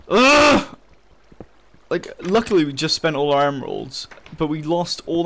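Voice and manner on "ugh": loud, high exasperated ugh; frustrated groan. Real, not acted. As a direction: let out a real groan of rage. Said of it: "I'm so pissed".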